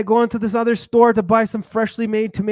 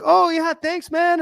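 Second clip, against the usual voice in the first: high-pitched friendly thank-you; acted cheery thanks; 9 semitones higher, slower than usual. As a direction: play the eager, grateful customer, up high. Acts his cheery thanks to shopkeeper.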